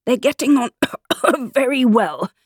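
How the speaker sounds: high, strained talk broken by a cough; choking voice. A narrator's reading. Hatter answering with bread in his mouth, choking. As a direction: choke on a mouthful mid-sentence. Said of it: "he said in a choking voice".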